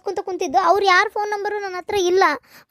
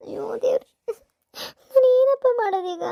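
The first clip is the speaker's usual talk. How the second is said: crying despair; 5 semitones higher than usual. Acted. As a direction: cry out to God in despair. Student panics over lost books. (ಅಳುತ್ತಾ ಹತಾಶೆಯಿಂದ ಕೂಗು)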